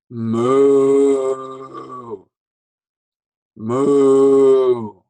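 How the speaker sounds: loud moo; cow moo. Shown as a demonstration. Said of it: "So a cow goes".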